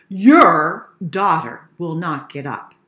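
loud, low, emphatic complaint; father's loud exasperated report. Acted. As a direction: put loud weight on 'Your daughter'.

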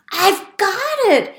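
high, loud eureka cry; excited mouse. Acted. Little mouse discovering his big idea, excited and high. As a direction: burst out with the idea. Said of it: "he said".

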